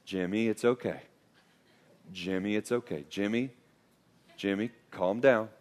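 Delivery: soft, low, soothing reassurance; calm, soothing voice. Retold or imitated. Dad to flailing son in grocery store, calm soothing voice. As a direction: talk a child down very gently, with pauses. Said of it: "Just this calm, soothing voice".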